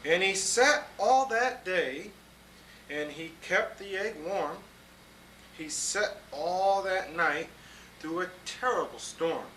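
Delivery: put-on lively read-aloud to children; storybook reading. A narrator's reading. Grown-up reading a picture book to kids, lively storytelling.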